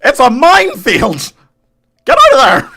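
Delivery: high, loud panicked cries; frantic high-pitched panic. Real, not acted. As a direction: shriek in real alarm at the bears.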